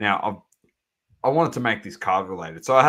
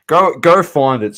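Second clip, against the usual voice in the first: loud call out; 6 semitones higher, 12 dB louder than usual. Real, not acted. Hyping up the chat.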